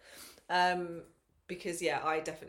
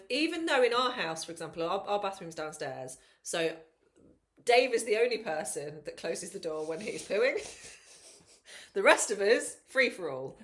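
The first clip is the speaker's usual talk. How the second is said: usual speaking voice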